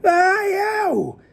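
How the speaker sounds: long high-pitched howl; roar. A narrator's reading. Giant writhing in a nightmare, roaring. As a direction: let out a high, drawn-out yowl. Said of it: "roared the flesh lump eater".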